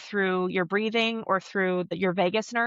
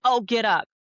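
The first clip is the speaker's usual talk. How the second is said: louder mock dad brush-off; dismissive dad; 2 semitones higher, 5 dB louder than usual. Dad to kid with skinned knee, mock dismissive. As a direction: order the kid up, lightly acted. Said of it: "dads are probably more prone to be like".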